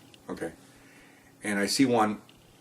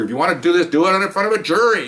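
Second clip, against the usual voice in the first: raised, higher-pitched exasperated retort; pissed-off judge; 9 semitones higher, 5 dB louder than usual. Retold or imitated. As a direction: imitate a judge fed up with repeating himself. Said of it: "getting all pissed off at her".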